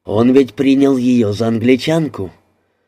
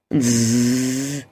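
long, level buzzing zzz; imitated buzz; 5 dB quieter than usual. A narrator's reading. Narrator imitates a buzzer in the plane, droning buzz. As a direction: hold a soft monotone buzz.

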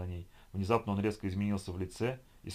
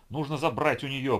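louder, higher frantic cry; frantic shout; 5 semitones higher, 5 dB louder than usual. A narrator's reading. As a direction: strain against the grip as you yell. Madman struggling to break free.

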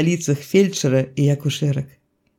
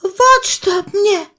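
very high, drawn-out oath; high, stretched-out vow; swearing peasant woman; 3 dB louder, much slower than usual. A narrator's reading. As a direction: squeal your innocence. Woman swears innocence to ceiling.

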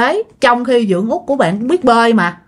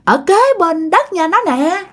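possessed man's voice; 7 semitones higher than usual. Retold or imitated. Possessed neighbor taunting the mourners, raised gloating voice. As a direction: gloat like a spirit owning the murder. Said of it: "Ổng nói vậy đó, ổng nói rằng á".

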